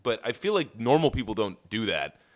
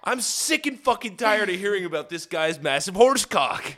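mock outrage; 10 semitones higher, 4 dB louder than usual. Mocking rant.

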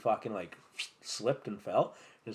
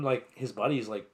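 ordinary talk, nothing marked

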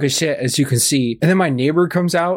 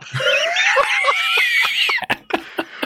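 very high howl of laughter; shrieking laugh. Real, not acted. Howls at own story.

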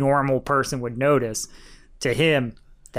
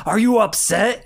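a higher, louder retold yell at the student; mock yelling; 6 semitones higher, 4 dB louder than usual. Bandleader yelling at the crying drummer, mock shout. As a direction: imitate a bandleader yelling the question. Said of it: "it just goes off on him".

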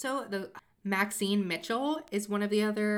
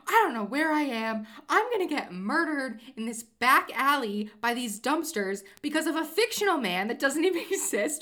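higher, louder frantic yelling; panicked yell; 7 semitones higher, 7 dB louder than usual. Retold or imitated. Lost in a back alley, yelling at Phoebe in panic. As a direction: shout in panic, lost and scared. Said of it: "I'm, like, yelling at Phoebe".

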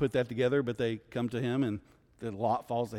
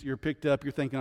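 ordinary delivery

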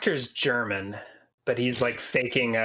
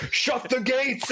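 mock shout; 11 semitones higher, slower than usual. Guard yelling in retold scene.

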